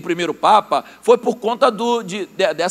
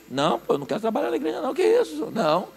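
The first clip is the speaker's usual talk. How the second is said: mock refusal; 5 semitones higher, 7 dB quieter, faster than usual. Worker refusing church work.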